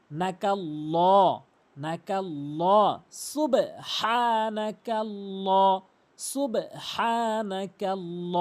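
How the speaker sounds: নাকাল্ল slow, ending in a long rounded "law"; heavy drawn-out L. Shown as a demonstration. Teacher repeating for learners, long heavy-L সুবহানাকাল্ল. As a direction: stretch the end of সুবহানাকাল্ল with a dark L. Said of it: "ল বলতে হবে".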